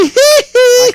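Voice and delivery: very high, slow laughing squeak; laughing falsetto. Real, not acted.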